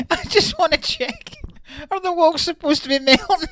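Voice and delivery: high mocking voice. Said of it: "echoing friend's call".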